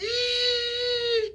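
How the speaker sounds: very high, long "eee" squeal; sustained high-pitched imitated toy squeal; squealing toy noise. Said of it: "toy sound".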